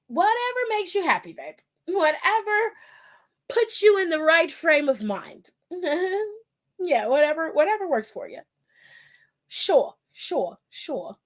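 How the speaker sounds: high, patronizing brush-off; sarcastic whatever. Real, not acted. Brushing off Gable's belt excuse, patronizing sarcasm. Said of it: "sarcastic dismissal".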